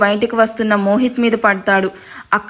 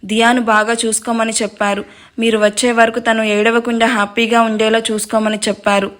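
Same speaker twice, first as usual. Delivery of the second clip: ordinary delivery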